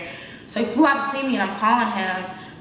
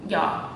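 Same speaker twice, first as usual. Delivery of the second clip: weary sigh; 5 semitones lower than usual. Real, not acted. Sighing to viewers before telling her friend's response. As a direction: sigh out the hurt before going on.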